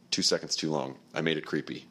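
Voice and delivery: ordinary talk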